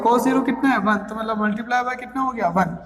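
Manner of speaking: ordinary talk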